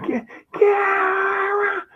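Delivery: very high, strangled mock shriek; imitated shouting. Worried masseur calling him off the road again, mock shouting. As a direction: stammer into a long, high-pitched yell. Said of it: "so she was, um, shouting".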